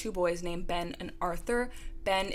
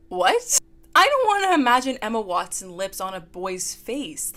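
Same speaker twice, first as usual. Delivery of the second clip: disgusted disbelief; 3 semitones higher, 8 dB louder than usual. Real, not acted. Reacting to the book's lips line, loud and appalled.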